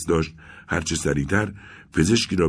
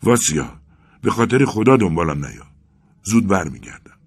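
loud, higher, hurried plea; frantic shout; 4 semitones higher, 6 dB louder than usual. A narrator's reading. Arkadi begs Vasya not to follow, frantic shout. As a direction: call it out in alarm. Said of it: "آرکادی سراسیمه فریاد زد".

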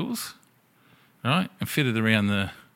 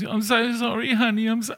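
much higher, sing-song sorry voice; drunk apology; 13 semitones higher than usual. Retold or imitated. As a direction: simper it like a drunk girlfriend.

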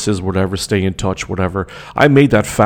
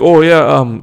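mock cousin voice; 6 semitones higher, 7 dB louder, slower than usual. Mocking the cousin asking name.

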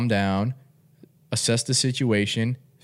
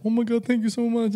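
grateful lady voice; 12 semitones higher than usual. Retold or imitated.

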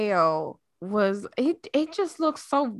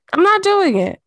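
loud, high, stubborn no; emphatic refusal; 10 semitones higher, 8 dB louder than usual. Real, not acted. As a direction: put your foot down, loud and high. Refusing to turn heat on.

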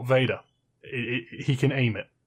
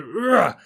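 sharp effortful grunt; 8 semitones higher, 5 dB louder than usual. Retold or imitated. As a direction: grunt as if shoving something away. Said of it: "You literally hear him grunt and go".

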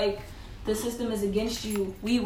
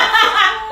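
high, loud burst of laughter; real laugh; 12 semitones higher, 10 dB louder than usual. Real, not acted. Laughing at friend's advice to use your voice, loud laugh.

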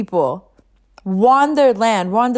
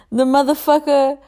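normal talking voice

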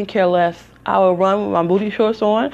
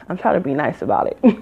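ordinary delivery

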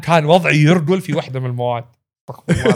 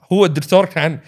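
ordinary talk, nothing marked

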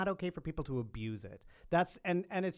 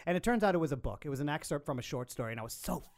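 ordinary delivery

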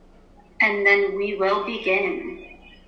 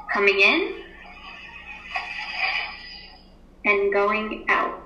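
guided meditation. Acted. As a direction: ease the class into stillness. Teacher leads class meditation.

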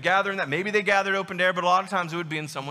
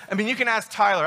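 ordinary talk

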